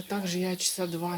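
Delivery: ordinary talk, nothing marked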